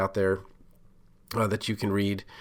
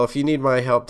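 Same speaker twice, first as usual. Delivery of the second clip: ordinary talk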